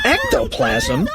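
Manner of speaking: very high questioning yelp; alarmed cry. A narrator's reading. Bug facing foamy hallway, high alarmed cry. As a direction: squawk it slowly, rising in alarm. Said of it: "cried Bug".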